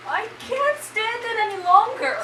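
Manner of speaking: distraught. Acted. Companion breaking down.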